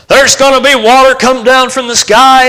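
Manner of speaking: high, announcing prophecy; a touch of a prophet's bold declaration; Noah proclaiming. Retold or imitated. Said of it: "Noah stands up and says".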